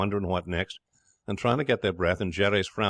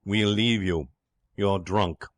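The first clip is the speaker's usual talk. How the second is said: measured, offended dignity; dignified; 3 dB louder, slower than usual. Acted. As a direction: announce the departure with stiff dignity.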